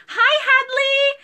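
high, loud cooing with a laugh; baby talk. Acted. Live seller greets a viewer's toddler in laughing baby talk. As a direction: coo the toddler's name. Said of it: "talking like you're the baby".

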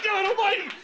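high, loud alarmed yelp; panicked cry. Retold or imitated. Traveler with police dog on his shoulders, panicked. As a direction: cry out in comic terror.

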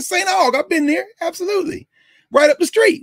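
light up like you know the place well; greeting a fellow alum with eager delight; excited recognition. Retold or imitated. Speaker excited, recognizing the school.

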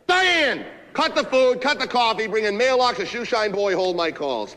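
high, loud string of commands; barking orders. Acted. Boss firing instructions at the secretary, loud bark. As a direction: holler orders across the office.

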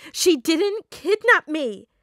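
high, loud, slow outburst; tearful shout. Acted. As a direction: scream the denial. Peter shouts in denial.

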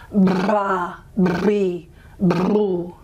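lips trilled on bra, bri, bru; bilabial trill. Shown as a demonstration. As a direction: buzz the lips before each vowel. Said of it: "practicing with a vowel afterwards".

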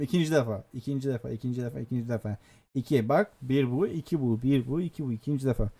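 low quiet grumbling to himself; frustrated mutter. Real, not acted. Gamer killed by his own bomb, muttering quietly. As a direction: mutter it under your breath, annoyed.

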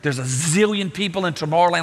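usual speaking voice

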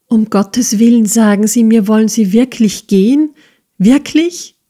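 plead, voice rising in panic; desperate pleading. A narrator's reading. Smike begs Nicholas desperately. (verzweifelt flehend, höher und lauter)

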